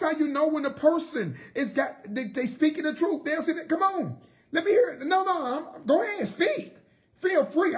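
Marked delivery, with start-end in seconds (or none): 3.7-7.8 daring challenge. Retold or imitated. Imitating a truthful person's challenge.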